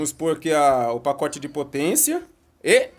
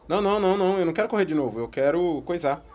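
usual speaking voice

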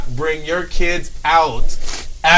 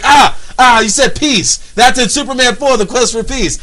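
higher, louder smug know-it-all; smug gotcha; 7 semitones higher, 5 dB louder than usual. Retold or imitated. Know-it-all pouncing on a movie reference. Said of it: "Someone's like".

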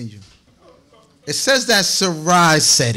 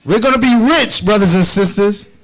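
higher and louder, rousing the brothers and sisters; excited rally; 5 semitones higher, 4 dB louder than usual. Real, not acted. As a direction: proclaim it with a preacher's lift. Rallying the congregation.

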